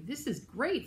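lightly acted delight; happy cry. A narrator's reading. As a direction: exclaim it as a pleased snowman. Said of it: "the snowman cried".

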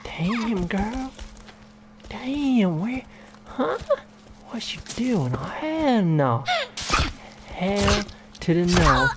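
very high, slow sassy diva voice; hammed-up sassy refusal. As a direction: play an outraged diva, stretching the words. Sassy put-on voice continues.

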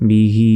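বিহি chanted with the final vowel held long; three-alif elongation. Shown as a demonstration. Teacher demonstrates three-alif length to students, বিহি drawn out. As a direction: sustain the last vowel of বিহি. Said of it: "এখানে তিন আলিফ".

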